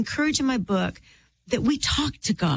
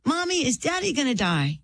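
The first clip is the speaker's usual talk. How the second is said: put-on higher-pitched child asking mommy; small son's question; 4 semitones higher than usual. Six-year-old asks mother about daddy, high childlike voice.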